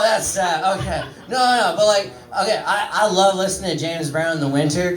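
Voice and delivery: usual speaking voice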